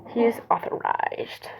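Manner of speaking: slow secretive whisper; slow hushed confidential whisper. Retold or imitated. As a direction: lean in and whisper it. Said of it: "It's like".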